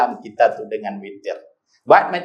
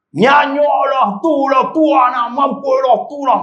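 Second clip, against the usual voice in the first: scolding husband; 10 semitones higher, 3 dB louder than usual. Retold or imitated. Husband scolding wife for glasses.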